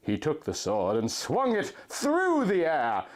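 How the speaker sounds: ordinary talk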